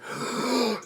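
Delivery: quick high gasp; imitated horrified gasp. Shown as a demonstration. Retelling how the cinema crowd gasped, a short gasp. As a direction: suck in air in mock shock. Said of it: "My gasp was better".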